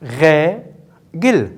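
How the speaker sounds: "Règle" in two separate beats, rè... gle; syllable by syllable. Shown as a demonstration. Teacher confirming the count to pupils, "Règle" split rè... gle. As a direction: leave a clear gap inside "Règle". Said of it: "Alors ce sont deux syllabes".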